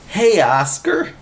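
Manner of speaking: high, slow holler; shouting over to Oscar. A narrator's reading. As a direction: call out across the park. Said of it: "he hollered".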